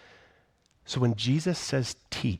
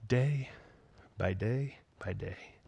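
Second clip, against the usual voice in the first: slow, hushed whisper; tender whispered repetition; whispered day by day; 5 dB quieter, slower than usual. Acted. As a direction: let it fade into a whisper. Closing comfort to listeners.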